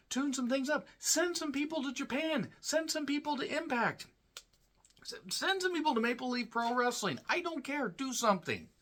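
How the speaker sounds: high-pitched frustrated pleading; fed-up fan voice, cracking high; exasperated rant. Real, not acted. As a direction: throw up your hands, voice way up. Ranting advice to Tony Khan.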